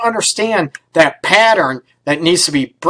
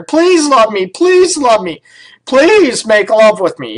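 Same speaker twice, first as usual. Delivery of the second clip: high, drawn-out mock pleading; sarcastic begging; 5 semitones higher, 3 dB louder than usual. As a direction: beg in an exaggerated, pathetic whine for love. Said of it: "they beg their partner".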